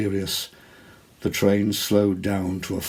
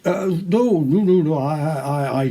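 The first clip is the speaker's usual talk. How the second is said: high, loud stammering no no no; flustered earnest denial; 8 semitones higher, 6 dB louder than usual. A narrator's reading. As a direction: insist in a panic, tripping over words. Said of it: "he assured her earnestly".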